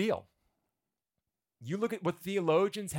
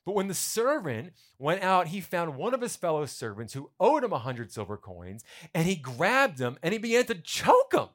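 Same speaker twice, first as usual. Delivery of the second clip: louder and higher, building as he reads; rising storyteller's outrage; dramatic reading; 4 semitones higher, 6 dB louder than usual. A narrator's reading. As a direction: push it as the story turns ugly. Reading the violent turn, building.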